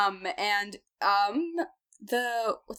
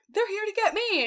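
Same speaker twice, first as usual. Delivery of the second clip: Arya's line pitched higher; higher worried voice; 11 semitones higher than usual. Acted. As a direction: voice her alarm up high. Arya voiced, high.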